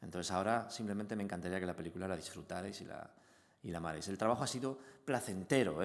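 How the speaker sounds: ordinary delivery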